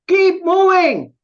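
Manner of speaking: high, loud, drawn-out yell; imitated shout. Truck driver yelling at the rider ahead, shouted. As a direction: yell it slowly, like an impatient order from behind. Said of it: "And he shouted".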